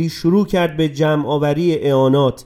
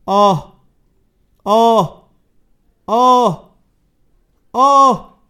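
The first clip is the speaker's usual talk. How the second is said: moans climbing higher and louder each time; wail, each moan higher than the last; rising moans; 6 semitones higher, 6 dB louder, much slower than usual. Acted. Tormented women in the dark, moaning ever higher.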